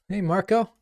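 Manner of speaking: ordinary talk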